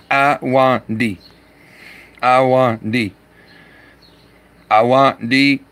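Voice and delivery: Awandi slowly split into syllables; careful pronunciation. Shown as a demonstration. Streamer practising a viewer's name, slow careful Awandi. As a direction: sound out "Awandi" syllable by syllable, punching it. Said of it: "Did I say it right?".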